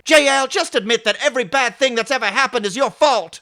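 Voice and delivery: high, loud mock accusation; hammed-up yell at the captain; angry accusation. Acted Picard and Raffi quarrel.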